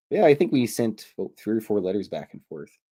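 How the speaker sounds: ordinary talk, nothing marked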